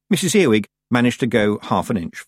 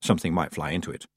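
low, soft aside at a quick pace; subdued echo; 5 semitones lower, 6 dB quieter, faster than usual. A narrator's reading. Tiffany shuts her mouth on Granny's warning, subdued echo. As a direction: drop it under your breath as an afterthought. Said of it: "Tiffany shut her mouth".

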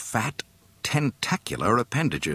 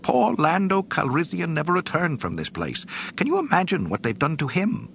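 high, fast and fretful; worried prissy robot; fussy droid; 11 semitones higher than usual. A narrator's reading. C-3PO voiced in audiobook.

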